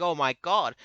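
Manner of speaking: loud, horrified mock cry; hammed-up shout of alarm; mock screaming. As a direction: yell it like a terrified soldier. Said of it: "And then they're screaming at it".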